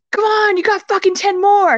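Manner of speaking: high, loud drill-sergeant trainer bark; yelling trainer. Retold or imitated. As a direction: yell it like a gym coach pushing the last reps. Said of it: "personal trainer that yells at you".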